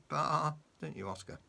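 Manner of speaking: ordinary delivery, nothing marked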